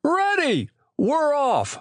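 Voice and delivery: very high answering cry that falls at the end; excited call. A narrator's reading. Boy answering as the ice boats set off, excited call. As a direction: call back high and eager, ending on a fall.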